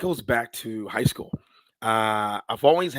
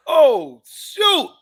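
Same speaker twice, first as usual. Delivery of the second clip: sudden much higher, louder startled outburst; shocked; 10 dB louder than usual. Retold or imitated. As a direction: jolt up in pitch like you just got caught off guard. Retold discovering finalist email.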